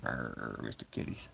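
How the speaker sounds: very low and soft, unvoiced; growly whisper. Streamer growling playfully at cat, whispered.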